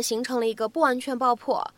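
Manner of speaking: usual speaking voice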